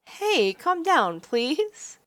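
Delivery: high, slow, soothing plea; calm him down; pleading heroine. Acted.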